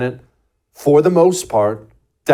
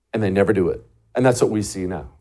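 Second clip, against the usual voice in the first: normal talking voice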